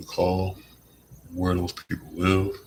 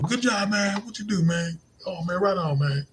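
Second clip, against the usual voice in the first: much higher, upbeat hype voice; high cheery praise; 13 semitones higher than usual. Shown as a demonstration. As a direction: cheer them on brightly. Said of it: "Just by going".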